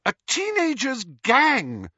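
high, loud dismissal; high-pitched motherly outrage; scornful shout. A narrator's reading. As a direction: throw it back with angry contempt. Mother scorns the army in quarrel.

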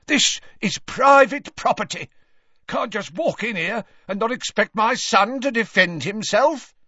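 loud, high, belligerent warning; aggressive. A narrator's reading. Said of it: "said Gaunt aggressively".

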